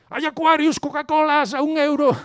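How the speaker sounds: high, loud hawker's cry; beach vendor shouting. Retold or imitated. Beach vendor crying out drinks over the sunbathers, loud and high. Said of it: "viene alguien y grita".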